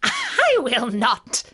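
high, loud, drawn-out witch refusal; wicked witch digging her heels in; witch's retort. Acted. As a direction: refuse slowly and haughtily as a witch. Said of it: "retorted the witch".

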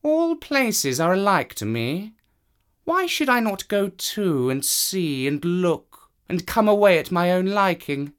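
high voice musing to himself; a proud cat thinking aloud. Acted. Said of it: "the cat said to himself".

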